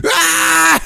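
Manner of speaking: loud, high screaming yelp; a high yell like a startled old man; pug scream. Retold or imitated. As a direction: mimic the dog screaming. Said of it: "it sounds like a person screaming".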